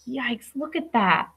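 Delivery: ordinary delivery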